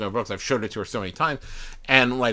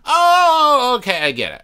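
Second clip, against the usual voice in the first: long, high, loud "oh" that settles down; dawning realization; 13 semitones higher, 10 dB louder than usual. Retold or imitated. Viewer understanding Blazing Saddles at last, exaggerated realization. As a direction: exaggerate the moment the joke finally lands. Acts out finally getting the joke.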